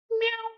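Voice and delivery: high, squeaky pretend meow; cat's meow. Imitates the cat in the story.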